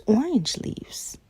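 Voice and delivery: ordinary delivery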